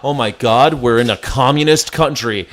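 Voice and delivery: ordinary talk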